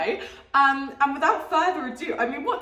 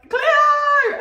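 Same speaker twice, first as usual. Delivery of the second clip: a much higher, louder mock Australian "Cleo!" with a falling end; Australian accent; 8 dB louder than usual. Vlogger imitating the Australians she heard at the airport, in a loud mock Australian accent. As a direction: shout a hammed-up Australian "Cleo!". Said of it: "everyone was speaking their Australian accents".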